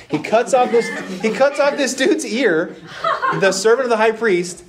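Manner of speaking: high voice laughing through the story; laughing while telling. Real, not acted. Preacher laughing as Peter cuts off an ear. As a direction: giggle as you tell it.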